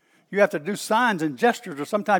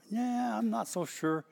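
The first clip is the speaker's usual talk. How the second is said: soft, slow, higher-pitched doubt; doubtful; 4 semitones higher, 8 dB quieter than usual. Acted. Believer doubting a work of God, hesitant and unsure. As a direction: drag it out quietly, unconvinced. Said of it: "Have you ever doubted".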